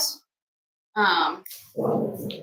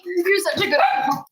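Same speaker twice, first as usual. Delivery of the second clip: talking to a dog; 8 semitones higher than usual. Real, not acted. Praising the dog.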